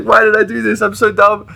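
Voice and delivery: high, loud, laughing self-reproach; mock self-scolding. Scolding himself after ruining the trip, laughing. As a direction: scold yourself while cracking up.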